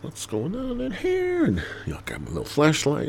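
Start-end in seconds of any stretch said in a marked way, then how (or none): 0.0-1.4 curious inspector. Retold or imitated. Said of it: "I'm like".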